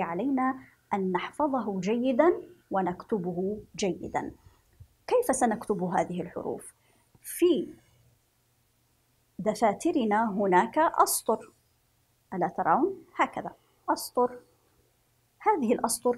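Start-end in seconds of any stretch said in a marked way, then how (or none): none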